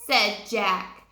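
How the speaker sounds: ordinary delivery, nothing marked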